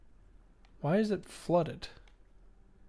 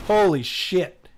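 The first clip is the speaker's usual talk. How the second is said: surprised; 3 semitones higher, 8 dB louder, slower than usual. Real, not acted. Startled by game monster.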